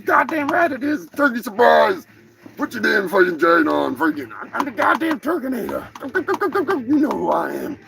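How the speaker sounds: a cocky cartoon turkey boasting; turkey character.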